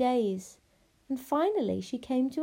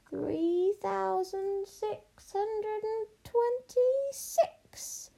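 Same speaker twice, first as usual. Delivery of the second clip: very high, very slow witch chant; witch counting; 11 semitones higher, much slower than usual. Acted. Witch ignores princess to count gold, droning. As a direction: intone the count in a long high drawl.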